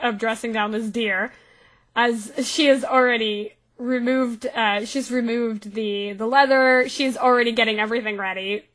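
ordinary talk

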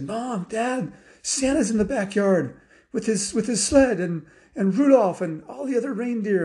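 put-on high, breathless-with-news kid calling parents; excited child. Girl tells Mom and Dad Santa's in the backyard, excited.

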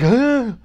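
high, loud mock gasp of alarm; freaked-out gasp. Retelling everybody watching the handshake, freaked-out gasp. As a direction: gasp sharply as a shocked crowd. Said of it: "Everybody's freaking out".

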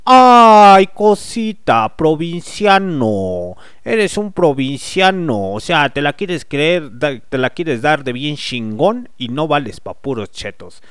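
slow, teasing chilango put-down; mocking Mexico City swagger; chilango accent. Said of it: "les aplico la del chilango".

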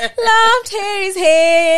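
high, loud and drawn out; ecstatic gushing. Real, not acted. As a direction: swoon over it, stretch every word. Gushing repetition, louder take.

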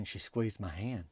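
hushed telling. Real, not acted. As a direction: tell it quietly, moving along. First telling of the tender moment.

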